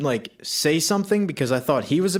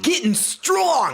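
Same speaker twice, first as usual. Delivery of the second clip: hyped shout; 11 semitones higher, much slower than usual. Acted. Bragging about working out, hyped shout. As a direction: pump it up like a gym bro. Hyping up workout talk.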